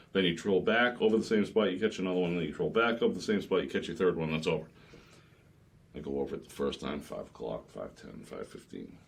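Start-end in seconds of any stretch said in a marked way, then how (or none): 5.9-9.0 very low, soft, trailing away; hushed suspense. Retold or imitated. Captain retelling a wait for the bite, hushed. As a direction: let it sink and fade as time passes.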